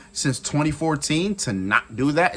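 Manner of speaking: usual speaking voice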